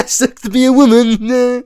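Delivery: high, loud laughing quip; cackling sarcasm. Real, not acted. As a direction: laughs then mocks. Sarcastic joke.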